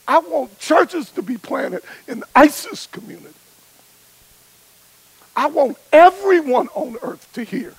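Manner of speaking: loud, high, climactic preaching; preaching at full force. Acted. Preacher to his audience, loud and fervent at the climax. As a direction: drive it home with fire.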